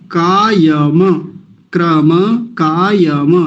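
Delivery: कायम drawn out slowly with a final vowel; slow careful recitation. Shown as a demonstration. Teacher modelling Sanskrit recitation, slowly. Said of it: "कायम नहीं कायम".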